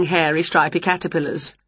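ordinary delivery, nothing marked

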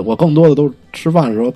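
ordinary talk

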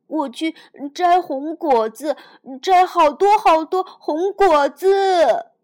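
building to a shout; a little dinosaur yelling his brave plan; loud determined cry. Acted. Child bravely running off.

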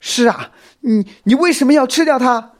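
very high, loud accusing demand; loud questioning. A narrator's reading. Xiao Mi confronting the spider, loud questioning. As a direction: shout the challenge, stumbling on the first word. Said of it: "小蜜也大声地质问".

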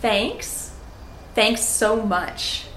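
slow, clear model for learners; teacher modeling slowly; slow repeat-after-me. Shown as a demonstration. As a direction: say it slowly so they can copy. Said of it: "You can say it after me".

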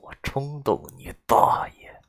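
slow, hushed, gritted-teeth fury; calm through gritted teeth. A narrator's reading. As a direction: hold the rage down under your breath. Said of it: "我咬着牙十分平静地".

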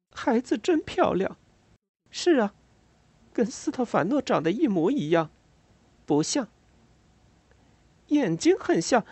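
high, lightly voiced woman. A narrator's reading. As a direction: pitch her up as Ada. Ada voiced in dialogue.

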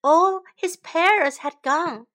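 ordinary delivery

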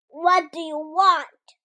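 put-on slow, loud, hostile old man demanding; loud and unwelcoming, each word dragged out; grumpy. A narrator's reading. Old hermit barking at the girl in his doorway. Grumpy neighbour confronts child.

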